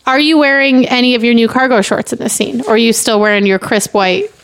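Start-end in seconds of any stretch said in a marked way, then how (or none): none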